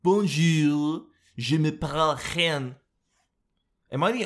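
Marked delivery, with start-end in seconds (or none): 0.0-2.8 slow mock French hen greeting. Vlogger trying the French hen voice again, mock accent. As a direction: play a hen putting on French airs. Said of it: "let's see what a French hen sounds like".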